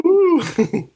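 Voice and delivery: a loud, high echoing cheer; playful whoo-hoo. Real, not acted. Host echoing the coach's cheer, playful loud whoo-hoo. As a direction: join in the whoop.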